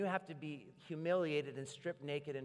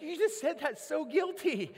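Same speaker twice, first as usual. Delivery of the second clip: much higher, louder teasing cry; excited teasing; 12 dB louder than usual. Retold or imitated. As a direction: tease her, half laughing. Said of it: "I'm like".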